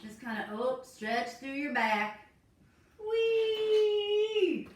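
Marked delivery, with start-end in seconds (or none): 3.0-4.7 high squeal of glee; squealed whee; playful whee. Acted. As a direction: let out a gleeful high whee. Playful stretch cue.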